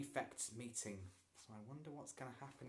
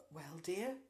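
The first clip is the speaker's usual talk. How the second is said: gently; 5 semitones higher, 4 dB louder than usual. A narrator's reading. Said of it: "Missus Hunter said gently".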